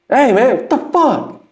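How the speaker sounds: high, slow mock outburst; hammed-up high complaint; mock angry. Rapper mocking the cussing engineer, high and slow.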